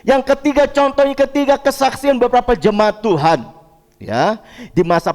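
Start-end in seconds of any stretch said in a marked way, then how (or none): 0.0-3.5 high-pitched, insistent pulpit voice; emphatic preaching. Real, not acted. Pastor assuring the congregation of God's presence, emphatic preaching.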